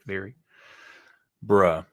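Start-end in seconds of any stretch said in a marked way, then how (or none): none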